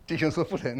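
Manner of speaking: ordinary delivery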